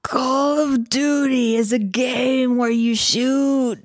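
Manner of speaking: slow, lightly voiced gamer bro. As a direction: drawl it like a gamer. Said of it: "but use your gamer voice".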